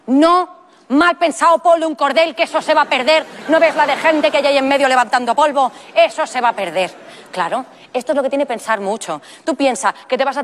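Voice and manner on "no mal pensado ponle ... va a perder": higher-pitched, scolding mock protest; acted-out indignant warning; exasperated objection. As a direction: object like a worried know-it-all watching. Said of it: "yo que pensaba mucho decía".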